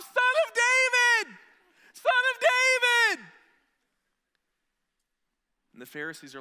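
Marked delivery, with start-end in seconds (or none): 0.0-3.3 much higher and louder, slow, far-carrying cheer; children's cheer. Retold or imitated. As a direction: shout it like a crowd of kids. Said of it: "The kids are still caught up".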